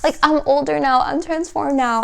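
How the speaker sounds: put-on high, fast little-kid voice, whiny; childish voice. Reader voicing the viewer as a child to grandma, high and whiny.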